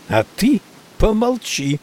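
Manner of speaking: high, sharp put-down; snapped order. A narrator's reading. Maggie silencing Crumley, high sharp snap. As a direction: cut him off hard. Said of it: "рявкнула Мэгги".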